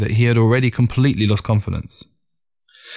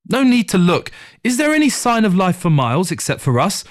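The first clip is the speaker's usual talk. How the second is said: wife's voice, pitched higher, bitter; 9 semitones higher than usual. A narrator's reading. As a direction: voice the grieving wife a bit higher. Wife voiced in story.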